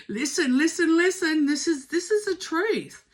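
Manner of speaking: high, loud, urgent repeated call; mock-urgent candle insisting; insistent loud voice. Candle flame insisting to the reader, loud and urgent. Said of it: "the candle's going".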